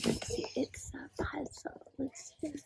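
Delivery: soft unvoiced whisper. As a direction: breathe the words under your breath. Echo of puzzle, very soft.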